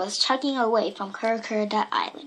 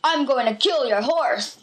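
story villain's threat, mildly raised; menacing shout. A narrator's reading. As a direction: threaten her in a put-on bully voice. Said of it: "he shouted to Pee-Pee".

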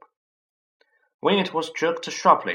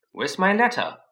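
louder, higher demand; 8 semitones higher, 5 dB louder than usual. Acted. Harry demands his letter from Uncle Vernon, louder. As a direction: confront him straight away.

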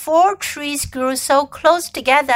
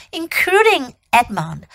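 usual speaking voice

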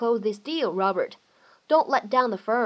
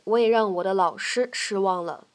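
normal talking voice

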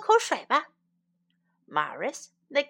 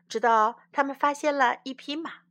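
plain reading voice

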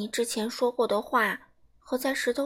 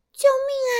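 much higher, loud panicked scream; crying for help; 13 semitones higher, 8 dB louder, much faster than usual. Acted. Baby dinosaur rolling down the hill, screaming for help. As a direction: yell for help as you tumble. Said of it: "尼大叫道".

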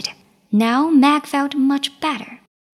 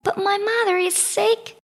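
pleading; 8 semitones higher than usual. Acted. Meg pleads with king.

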